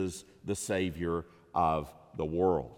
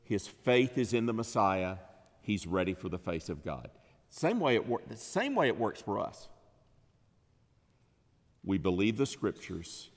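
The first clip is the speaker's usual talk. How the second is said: normal talking voice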